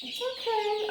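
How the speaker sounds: high, soft soothing coo. Real, not acted. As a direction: comfort a scared chick softly. Soothing chicks.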